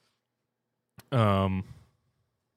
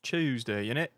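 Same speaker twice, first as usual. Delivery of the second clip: "Tuesday, innit?" in put-on British accent; mock British; 2 semitones higher than usual. Streamer repeating a British trope, mock British. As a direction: make "Tuesday" a British "CHEWS-day". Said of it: "make fun of us for speaking like that".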